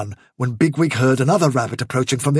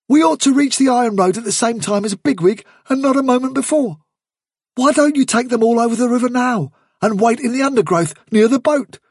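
higher-pitched, eager character voice; keen rabbit adviser; 10 semitones higher than usual. Acted. Blackberry urging Hazel to get over the river, keen. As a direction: pitch it up and urge the plan.

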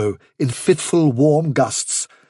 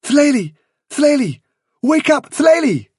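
high, urgent waking call; 13 semitones higher, 4 dB louder than usual. Acted. Hyzenthlay waking Bigwig with bad news, urgent. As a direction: call the name sharply, pitched right up. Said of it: "hear his name repeated urgently".

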